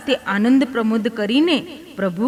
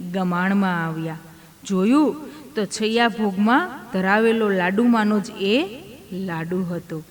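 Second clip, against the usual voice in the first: usual speaking voice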